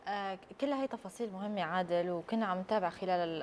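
ordinary talk, nothing marked

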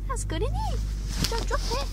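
high, bright dog-talk voice; pet talk. Real, not acted. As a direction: praise the dog in a high sing-song. Talking to her dog.

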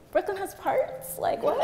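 slow, high puzzled question; playfully incredulous, drawn out; mock bafflement. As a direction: act out being caught out and clueless. Acts her baffled reply.

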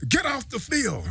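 mock shout, pitch jumping up; imitated coach's order. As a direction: mimic an angry coach ordering a player away. Coach's order acted in story.

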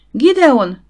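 high, loud shriek of a name. A narrator's reading. Wife shrieks a warning to her husband. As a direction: scream his name in terror. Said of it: "süvöltötte Harriet".

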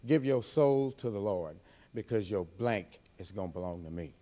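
menacing threat. Acted. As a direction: drop low and threaten him. Threat to rival at promo end.

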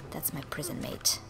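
soft voiceless aside; whisper. Real, not acted. Gamer whispering about her cellmate. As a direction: whisper it like a secret. Commenting on game character.

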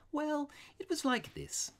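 very high, artless priest voice; unaffected. A narrator's reading. Priest starts explaining to the robber, unaffected. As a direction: begin simply in a high voice. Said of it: "speaking in the same unaffected way".

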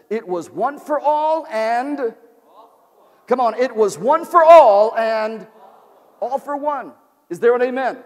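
a preacher hammering one for all, all for one; preaching climax. Acted. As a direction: rise up and call it out to the congregation.

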